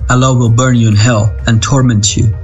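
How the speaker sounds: usual speaking voice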